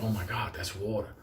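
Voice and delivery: soft, low, awed murmur; said to himself. Retold or imitated. Thirsty man spotting a river, hushed to himself. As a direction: drop right down to a private realization. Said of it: "So I said to myself".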